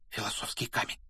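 ordinary delivery

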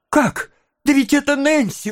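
put-on high, astonished boy's cry; amazed exclamation. A narrator's reading. Oliver recognising Nancy, astonished exclamation. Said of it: "воскликнул Оливер".

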